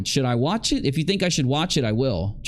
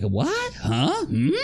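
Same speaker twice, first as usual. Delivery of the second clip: mock puzzled what; 9 semitones higher, much slower than usual. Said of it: "he's like".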